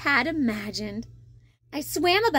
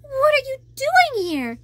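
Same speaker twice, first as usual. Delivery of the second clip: high, louder astonished question; surprised; 10 semitones higher, 4 dB louder than usual. Acted. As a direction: ask it in disbelief. Surprised to find Sebastian.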